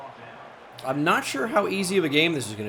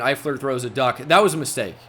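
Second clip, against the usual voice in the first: ordinary delivery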